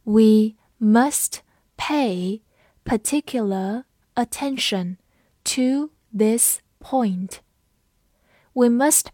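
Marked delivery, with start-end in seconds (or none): 0.0-7.4 very slow, word by word with pauses; spaced-out teaching pace, each word clear; slow read-along. Shown as a demonstration. Teacher has learners read along slowly, slow word-by-word delivery. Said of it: "跟着我慢读一遍".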